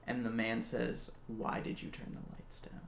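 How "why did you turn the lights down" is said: soft whispered menace; creepy whisper. Retold or imitated. Stalker on phone to babysitter, whispered. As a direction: breathe it like the stalker. Creepy caller in horror story.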